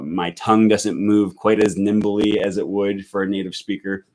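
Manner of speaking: normal talking voice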